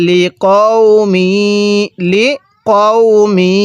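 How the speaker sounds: লিকওমি drawn out with rounded "qow"; measured tajweed model of লিকওমি; correct slow recitation. Shown as a demonstration. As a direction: hold the "ow" and "mee" of লিকওমি. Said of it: "লিকাউমি পড়া যাবে না".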